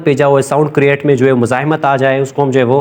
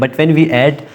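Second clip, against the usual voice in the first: very slow, word-by-word dictation of a definition; measured classroom dictation; much slower than usual. Shown as a demonstration. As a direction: give students time to write each word. Teacher dictates the suffix definition.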